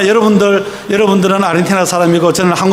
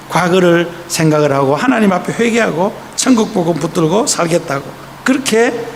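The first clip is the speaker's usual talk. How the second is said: ordinary delivery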